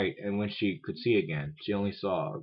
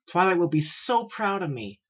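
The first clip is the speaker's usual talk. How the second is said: high-pitched, eager little filly voice; young unicorn character; 10 semitones higher, 4 dB louder than usual. A narrator's reading. Young unicorn anticipating her mentor's praise, eager and high.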